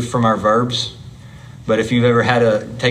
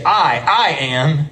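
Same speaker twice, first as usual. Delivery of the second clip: "I" repeated with weight, raised pitch; emphatic I; 3 semitones higher, slower than usual. Shown as a demonstration. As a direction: lean hard on the doubled "I". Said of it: "it makes it emphatic".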